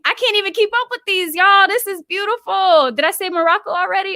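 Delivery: high, giddy rush of joy; can't keep up with all the love; overwhelmed excitement. Real, not acted. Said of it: "I'm getting very emotional".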